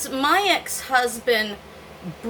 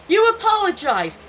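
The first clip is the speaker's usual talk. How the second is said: mocking husband's demand; 9 semitones higher, 4 dB louder than usual. Husband following her demanding apology.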